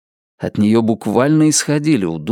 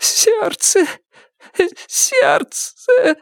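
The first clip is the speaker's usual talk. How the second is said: having a heart attack; much slower than usual. Acted. Woman clutches chest, heart attack.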